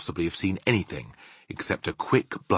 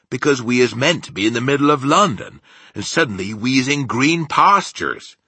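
raised, loud BFG protest; agitated giant; 6 semitones higher, 7 dB louder than usual. Acted. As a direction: explain in loud bewilderment as the giant. Narrator voices the agitated BFG.

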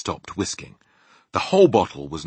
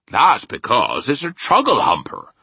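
loud, raised rustic giant voice; friendly giant, emphatic; 4 semitones higher, 8 dB louder than usual. A narrator's reading. Giant names the dream for Sophie, loud rustic declaration. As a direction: declare it loudly as a homely giant.

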